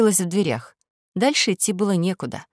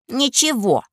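little princess; 6 semitones higher, slower than usual. Acted. Princess answers.

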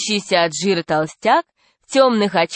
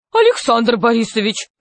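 raised pitch, taken aback; shocked exclamation; 5 semitones higher than usual. A narrator's reading. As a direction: exclaim in indignant disbelief. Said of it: "воскликнула Мария Павловна".

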